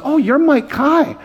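little boy gazing up in wonder, mildly voiced; lightly voiced awed kid. Six-year-old greets the pastor, lightly voiced awe. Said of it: "and he goes".

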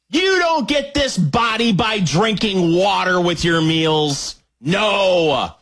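high-pitched comic rant; hammed-up indignation, stretching out the ending; mock outrage. Host ranting to YouTube viewers about soda, mock-outraged.

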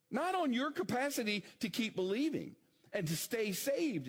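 preaching force. Acted.

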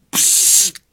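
long hissed exhale; hissing psst sound. A narrator's reading. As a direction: let out a sharp hiss of air. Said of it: "udělal maličký".

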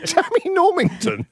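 high-pitched, helpless giggle through words; laughing. Real, not acted. Host cracking up at a listener's name, laughing.

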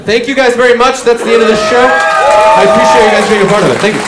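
comic wrapping up the set, loud and fast; closing shout. Real, not acted. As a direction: project the thank-you over the applause.

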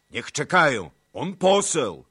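high and loud; loud objection. A narrator's reading. Cossack arguing he is an envoy, loud. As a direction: insist over the noise. Voices argue in council.

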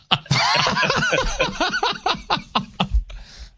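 long, high laugh. Real, not acted. Laughing at retold council blunder.

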